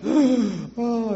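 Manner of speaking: high, loud mock wail of a newborn; baby starting to cry. Preacher playing the newborn as it bursts into tears, high mock wail. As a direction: let out a newborn's first hammed-up cry. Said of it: "and he starts crying".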